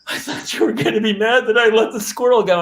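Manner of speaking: a lightly mimicked sob-story plea; crying daughter. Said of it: "She was like".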